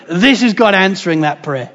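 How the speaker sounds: ordinary talk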